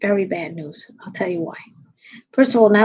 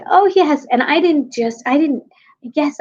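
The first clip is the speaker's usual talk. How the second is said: high, loud giggly mock of a smug politician; mocking Kamala; 7 semitones higher, 4 dB louder than usual. Host mocking Kamala's interview laugh, high and loud. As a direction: ham up her breezy self-satisfied yes. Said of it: "she's laughing like she's so hip".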